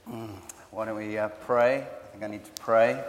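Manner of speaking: ordinary talk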